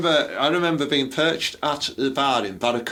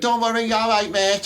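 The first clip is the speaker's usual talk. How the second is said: higher mocking girl voice; mock daughter; 9 semitones higher than usual. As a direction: ham up the daughter in the bar. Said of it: "And she went".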